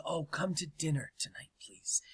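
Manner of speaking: sweet whisper. Retold or imitated. Kathleen inviting director to dinner.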